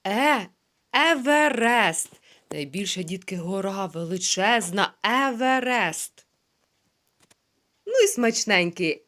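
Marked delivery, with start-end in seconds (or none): 0.9-2.2 "Еверест" said slowly with every sound clear; slow, clear "Еверест" for children to repeat; distinct slow "Еверест"; slow model word. Shown as a demonstration. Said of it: "model word for repetition". 5.0-6.3 "Еверест" said slowly with every sound clear; slow, clear "Еверест" for children to repeat; distinct slow "Еверест"; slow model word. Shown as a demonstration. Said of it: "model word for repetition".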